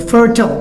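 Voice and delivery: fertile cut short to FUR-tl; American-style fertile, no "isle" sound; American pronunciation. Shown as a demonstration. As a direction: say fertile with a short schwa ending. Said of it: "Americans will just shorten the word".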